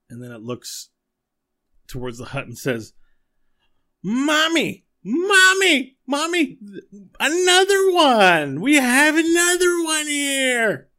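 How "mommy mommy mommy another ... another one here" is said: put-on very high, loud, drawn-out childish shrieks; excited monster child yelling. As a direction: shriek for mommy like a thrilled monster kid. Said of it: "And it yells it out".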